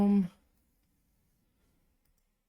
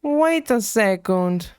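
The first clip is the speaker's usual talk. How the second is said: normal talking voice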